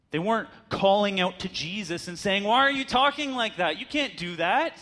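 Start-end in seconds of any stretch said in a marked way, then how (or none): 2.4-4.7 higher, louder mock protest from the crowd; mock call-out. Preacher voices Pharisees calling out to Jesus, mock indignant. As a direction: play a Pharisee openly objecting, lightly hammed.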